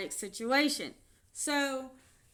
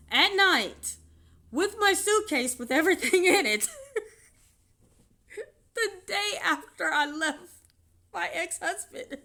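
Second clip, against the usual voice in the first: crying now; 8 semitones higher, 8 dB louder than usual. Real, not acted. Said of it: "I'm having a mental breakdown".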